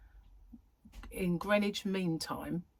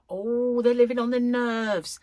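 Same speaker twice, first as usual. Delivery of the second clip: gossiping neighbour; 5 semitones higher, 8 dB louder than usual. Retold or imitated. Voicing gossiping neighbours on buses.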